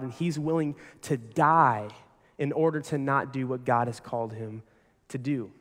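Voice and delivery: ordinary talk, nothing marked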